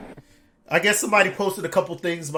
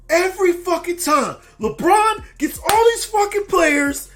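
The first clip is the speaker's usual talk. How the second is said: very high, loud, slow mock rant; retold yelling; 14 dB louder, slower than usual. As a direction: shout it like a fed-up fan ranting. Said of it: "he was just yelling through the phone".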